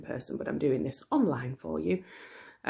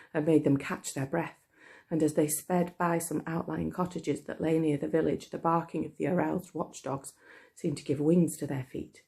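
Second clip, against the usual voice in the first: ordinary delivery, nothing marked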